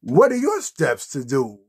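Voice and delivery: ordinary talk, nothing marked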